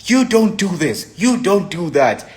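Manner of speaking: high, emphatic imitated scolding; defiant. Girlfriend laying down rules during a fight, defiant. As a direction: lay down the rules, pitch jumping up. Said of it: "so defined. She's like".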